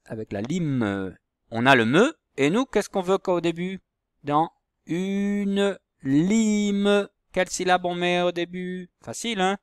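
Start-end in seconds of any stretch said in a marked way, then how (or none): 4.2-7.1 very slow, high, stretched words; high, lingering teacher enunciation; sounding out syllables. Shown as a demonstration. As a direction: draw each word out for beginners. Sounding out word for pupils.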